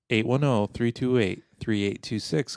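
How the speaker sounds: number rattled off in quick groups; brisk hotline number read-out; quick phone number. Real, not acted. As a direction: reel off the digits briskly. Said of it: "I have to say it fast".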